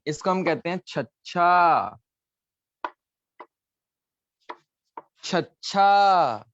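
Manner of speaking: slow, clear teacher model of छच्छा; drawn out छच्छा for the class; slow model letter name. Shown as a demonstration. Teacher saying छच्छा slowly for kids to repeat.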